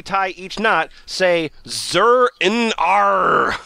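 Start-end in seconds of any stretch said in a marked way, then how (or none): 1.7-3.6 very slow, drawn-out chant; mysterious incantation. Acted. Reader intones magic words while tying knots, mysterious and slow. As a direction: stretch each syllable like a spell. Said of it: "speaking in tongues kind of thing".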